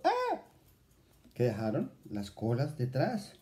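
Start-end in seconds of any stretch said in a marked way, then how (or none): 0.0-0.4 sudden high shriek of dismay; a short high gasp-cry, startled; narrated cry. A narrator's reading. Pastorcita sees her tailless sheep, high cry. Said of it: "mas dio un grito".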